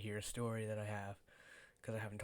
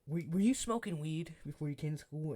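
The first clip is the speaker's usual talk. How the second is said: teacher voice; 4 semitones higher, 6 dB louder than usual. Retold or imitated. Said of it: "she's like".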